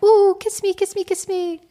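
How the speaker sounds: high-pitched cooing little taco voice; kissy lipsticked taco. Acted. Mocking the cartoon taco with lipstick on, high kissy voice. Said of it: "little mini taco with lipstick on".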